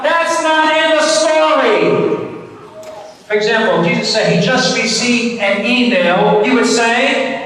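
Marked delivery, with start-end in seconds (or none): 0.0-2.2 drawn-out, higher-pitched call-out; yelled out. Shown as a demonstration. Leader showing children how to interrupt the deacon, yelled out. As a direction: yell it slowly, stretching each word. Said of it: "you yell out".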